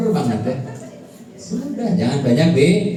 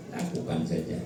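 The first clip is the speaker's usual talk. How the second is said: calm mantra; 5 semitones lower than usual. Acted. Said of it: "calm repeated mantra".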